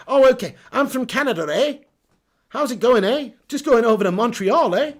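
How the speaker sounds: perky mock Canadian accent; badly faked, cheery Canadian accent. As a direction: put on a Canadian accent, tagging each eh. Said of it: "Do a Canadian accent".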